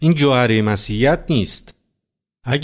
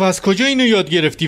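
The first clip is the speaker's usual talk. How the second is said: much higher, mock-horrified question; horrified father; 10 semitones higher than usual. Father to his card-savvy daughter, imitated horror. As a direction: act out a father's shocked alarm. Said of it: "وحشت کرده بود".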